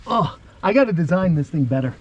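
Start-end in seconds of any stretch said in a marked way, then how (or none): none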